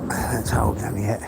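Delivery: whispered curse under breath; muttered whisper. Real, not acted. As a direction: mutter it to yourself. Muttering to himself.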